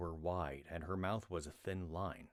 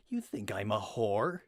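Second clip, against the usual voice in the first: offended outburst; 4 semitones higher, 7 dB louder than usual. Acted. Rat offended by Glawin's question.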